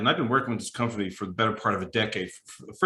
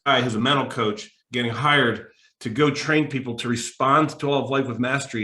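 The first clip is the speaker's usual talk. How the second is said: ordinary talk, nothing marked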